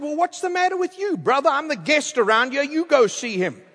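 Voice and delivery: high-pitched mock indignation; indignant retort. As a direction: retell it as an affronted protest. Indignant retort to pastor in story.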